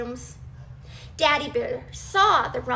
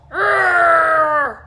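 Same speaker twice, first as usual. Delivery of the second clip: a loud growling shout; angry bear growl; 3 semitones higher, 12 dB louder than usual. Acted. Daddy Bear roaring over his eaten porridge. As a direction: roar out the frustration.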